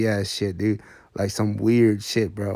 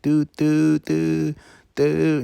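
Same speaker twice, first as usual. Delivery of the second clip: horn beeps; 6 semitones higher, much slower than usual. Retold or imitated.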